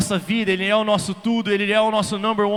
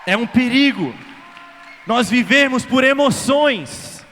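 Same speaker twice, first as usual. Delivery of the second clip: louder, higher, hammered warning; fervent preaching; 4 semitones higher, 6 dB louder than usual. Acted. Preacher warning the congregation, loud and fervent. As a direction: preach the danger full force.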